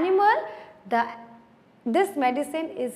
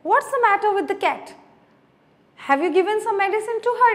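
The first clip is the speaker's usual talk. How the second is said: higher and rushed, astonished; amazed question; 7 semitones higher, much faster than usual. Acted. Aunt Polly, amazed at the cat, quickly questions Tom. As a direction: ask in quick disbelief. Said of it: "asked in amazement".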